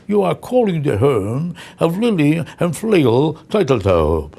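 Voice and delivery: slow, stiff, put-on announcer-like message; pompous machine greeting. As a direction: overdo the formal recorded message. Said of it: "Who talks like that?".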